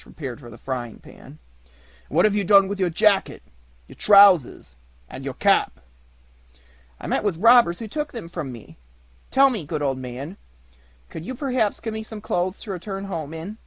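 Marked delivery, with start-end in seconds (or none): 2.1-5.8 put-on loud, insistent old man voice; projecting storybook old man, inquisitive; old man questioning. A narrator's reading. 7.0-13.6 lightly voiced puppet, raised pitch; a higher, earnest puppet voice; puppet pleading. A narrator's reading.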